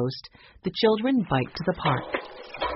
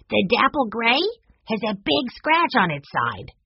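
put-on high child voice making a report; young boy's voice; 6 semitones higher than usual. A narrator's reading. Benny reports the scratch to Joshua, high boyish voice. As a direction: pipe up like a little boy.

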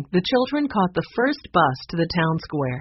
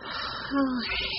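soft, drawn-out breath; long sigh; 7 semitones higher, 8 dB quieter than usual. A narrator's reading. Jessie unsure what to do, long soft sigh. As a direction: let out a quiet acted sigh. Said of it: "drew in a long breath".

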